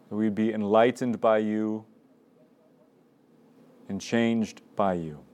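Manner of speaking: measured prayer voice with long pauses; opening prayer. Real, not acted. Leader praying aloud before the Gospel, calm and unhurried.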